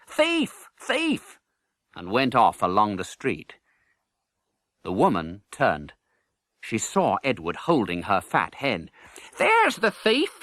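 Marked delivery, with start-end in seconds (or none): 0.1-1.4 high, sharp cry of alarm; shouting thief. Acted. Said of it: "He shouted". 9.4-10.4 high, slow indignant cry. Acted. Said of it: "she cried".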